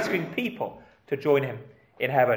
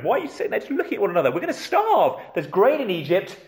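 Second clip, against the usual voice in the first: put-on grumpy old father's scolding, pitched up; 4 semitones higher than usual. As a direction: scold them impatiently, rushed at first, voice jumping high. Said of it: "Jacob's grumpy with them".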